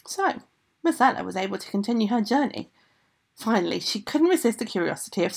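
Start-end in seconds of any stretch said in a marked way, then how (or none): none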